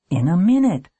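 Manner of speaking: a touch of annoyance; irritable. A narrator's reading. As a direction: snap it a little impatiently. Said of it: "she said irritably".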